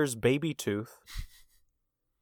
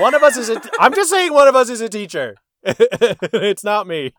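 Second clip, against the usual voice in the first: laughing; 7 semitones higher, 8 dB louder than usual. Real, not acted. Teasing through laughter, loud.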